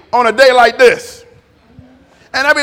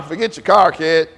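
normal talking voice

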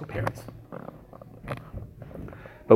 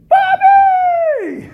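very high, loud cry of recognition; shocked cry; 10 dB louder than usual. Retold or imitated. Father sees his estranged son at the door, a very high, loud cry of surprise. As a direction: shout the name in disbelief.

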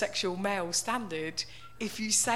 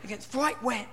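ordinary delivery

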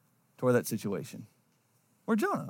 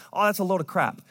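ordinary delivery

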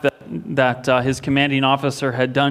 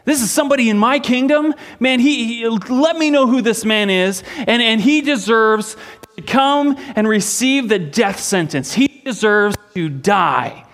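higher and louder, outraged king demanding justice; enraged outburst; 9 semitones higher, 5 dB louder than usual. Retold or imitated. King David, hearing the prophet's story, furious outraged shout. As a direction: blurt it out in rising fury, demanding the culprit's name. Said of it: "he was enraged".

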